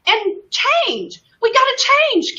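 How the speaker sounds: high, urgent rallying cry; worked-up, high-pitched exhortation; impassioned plea. Real, not acted. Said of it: "Am I yelling?".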